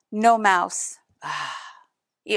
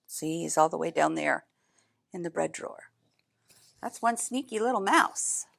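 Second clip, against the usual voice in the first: plain reading voice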